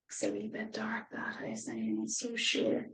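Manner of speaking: quiet, half-whispered unease; hushed. Real, not acted. Reader hushing over a dark house picture. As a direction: drop to a hush about the dark house.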